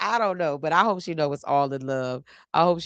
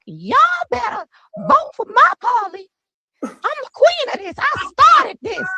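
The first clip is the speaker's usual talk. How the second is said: much higher, loud, hammed-up proud mama voice; Frederica Wilson impression; 6 dB louder than usual. Imitating Paul's mama, stumping loudly for her son. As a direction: play the queen of the town, boasting loudly. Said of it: "He made me imitate his mama".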